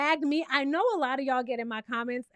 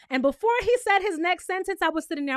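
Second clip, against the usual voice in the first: ordinary delivery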